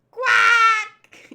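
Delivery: high, very loud, stretched quack; duck squawk. Acted. The duck caught on a fishing line, loud squawk. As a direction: blast out a startled duck quack.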